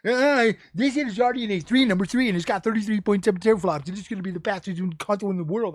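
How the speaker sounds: mocking arguing buddy. Streamer imitating his buddy arguing about console specs, mocking rush. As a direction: rattle it off fast, imitating an overexcited buddy. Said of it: "He argued with me".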